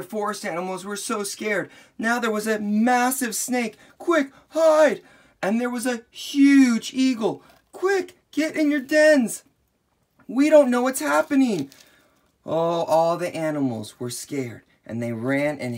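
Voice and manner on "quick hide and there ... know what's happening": high, loud hurried calls; urgent warning. Acted. Animals warning each other to hide, urgent high calls. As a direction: urge everyone to hide now.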